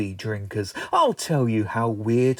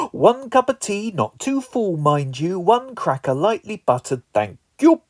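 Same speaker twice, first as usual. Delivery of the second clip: put-on a light, higher old gran voice; imitating gran; 7 semitones higher, 5 dB louder than usual. Comedian mimics his gran asking for tea, lightly higher. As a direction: play a fussy grandmother ordering tea. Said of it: "My gran always used to say".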